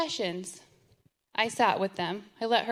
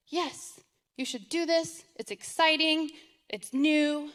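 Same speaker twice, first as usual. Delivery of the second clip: mocking cheerleader; 6 semitones higher, 4 dB louder than usual. Mocking mentors' cheerful encouragement.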